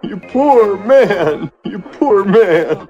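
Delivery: slow exaggerated sympathy; mock pity. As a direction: drag out the pity, slowly.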